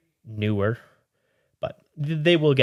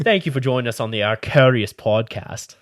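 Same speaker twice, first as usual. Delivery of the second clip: put-on a shaky, loud Aussie accent; bad Aussie accent; 7 dB louder than usual. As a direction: do a bad Aussie accent, drifting toward British. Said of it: "Aussie accent?".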